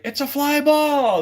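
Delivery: high, slow goofy put-on voice; silly fly ball call. Showing how he'd bait the players, high and slow. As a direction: sing out the distraction call. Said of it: "be like".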